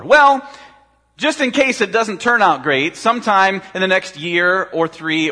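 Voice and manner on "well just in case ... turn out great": mock salesman. Preacher mocks salesman's reassurance.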